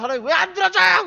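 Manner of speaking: hammed-up plea to God, half crying; whiny complaining prayer. Preacher imitates a believer complaining to God, mock whine. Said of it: "그러다가 막 울어".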